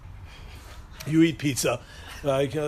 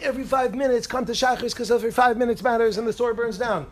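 higher-pitched mock lecturer; speech-giver; 6 semitones higher than usual. Speaker parodying the punctuality speech, high preachy nagging. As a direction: rattle off a sermon-like nag, pitched up. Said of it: "You know the speech when they go on".

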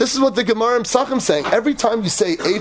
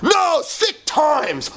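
high slow put-on voice of a brash visitor; lightly voiced eager fan; imitating visitor; 5 semitones higher, much slower than usual. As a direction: drag out the praise like a clueless guy. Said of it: "He goes".

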